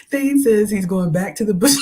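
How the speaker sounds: laughing. Real, not acted. Laughing, shouting chat.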